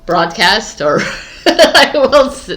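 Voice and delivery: giggling through the words; can't stop laughing mid-line. Real, not acted. Laughing fit while recording.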